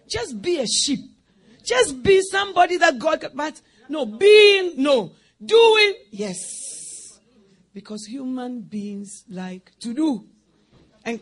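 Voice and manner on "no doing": ordinary talk, nothing marked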